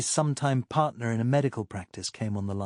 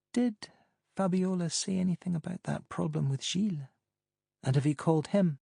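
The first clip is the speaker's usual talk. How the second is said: lightly voiced woman, higher pitch; higher woman's voice; 7 semitones higher than usual. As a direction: play Pamela, amused and higher. Pamela asking on phone.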